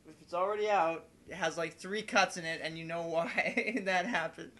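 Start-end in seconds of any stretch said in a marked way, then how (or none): none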